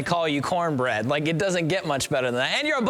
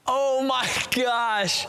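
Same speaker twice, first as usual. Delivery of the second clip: drawn out very slowly, floored; stunned oh my gosh; 5 semitones higher, much slower than usual. Real, not acted. Host reacting to the taser story, slow stunned disbelief. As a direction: let each word stretch in disbelief.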